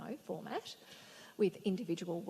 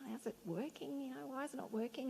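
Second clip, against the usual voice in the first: quiet puzzling; 4 semitones higher, 8 dB quieter than usual. Acted. Tree doctor examining quietly.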